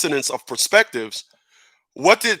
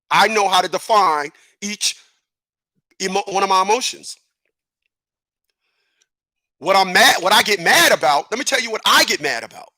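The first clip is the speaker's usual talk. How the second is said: usual speaking voice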